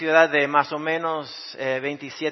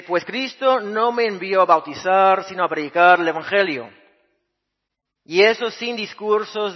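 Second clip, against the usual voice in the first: raised, earnest Bible reading; scripture reading; 4 semitones higher, 5 dB louder than usual. Acted. Pastor reads First Corinthians to the congregation, earnest reading. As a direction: read Paul's words out to the church.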